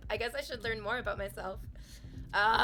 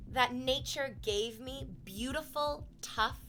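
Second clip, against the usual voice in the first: savoring slowly; 2 semitones higher, slower than usual. Real, not acted. Savoring compliment.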